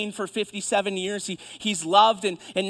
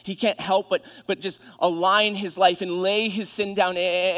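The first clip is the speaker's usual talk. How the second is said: usual speaking voice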